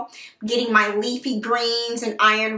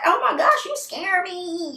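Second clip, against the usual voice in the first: mock alarm; 7 semitones higher than usual. Patient reacting to the doctor's warning, in high mock alarm. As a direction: act out a startled reply, stretching the end. Acting out her alarmed reply.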